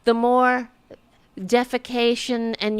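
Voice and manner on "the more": usual speaking voice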